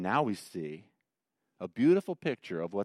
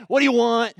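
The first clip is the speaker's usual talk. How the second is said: brusque, impatient clerk, higher and louder; rude order-taker; 10 semitones higher, 8 dB louder than usual. Retold or imitated. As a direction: bark the order question at a customer. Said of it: "a little on the rude side".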